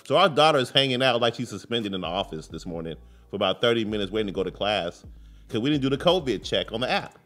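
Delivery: ordinary talk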